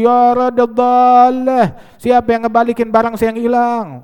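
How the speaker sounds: long-drawn, high loud wail; acted full-voiced public cry. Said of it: "Jumat berikutnya ngejerit lagi".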